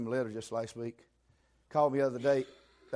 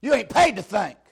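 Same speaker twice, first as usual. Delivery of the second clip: loud shouting preacher; 7 semitones higher, 12 dB louder than usual. Retold or imitated. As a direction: yell it like a drill sergeant. Preacher imitating drill training.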